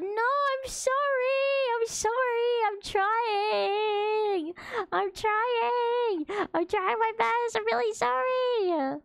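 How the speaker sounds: high, tearful voice breaking on the apology; apologising to chat while crying; real crying. Real, not acted. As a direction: choke the words out through real tears. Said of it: "Why am I getting all choked up".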